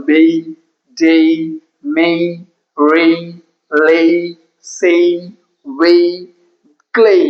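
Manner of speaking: bay, day, may, each ending in a held "ay-ee" glide; one word at a time from bay to clay, pause after each, full "ay" vowel; A-Y word drill. Shown as a demonstration. Teacher drilling A-Y words, bay to clay with sustained "ay". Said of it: "એનું ઉચ્ચારણ થશે એ ઈ".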